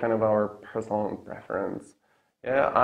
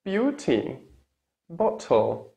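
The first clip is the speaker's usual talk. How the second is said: British accent with crisp middle T's; British true T; 6 semitones higher, much slower than usual. Shown as a demonstration. Teacher models British words, true T. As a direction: say it British, every T released. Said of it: "what the British would say".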